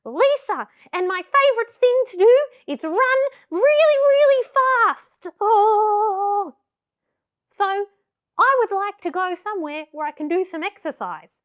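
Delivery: very high, excitable puppet voice; Lisa. Pretend. Puppet telling its puppeteer about running, high eager voice. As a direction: introduce yourself as an eager squeaky puppet. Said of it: "My name's Lisa".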